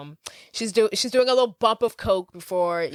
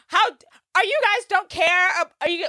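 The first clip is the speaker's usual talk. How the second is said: indignant; 11 semitones higher than usual. Retold or imitated. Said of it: "She's like".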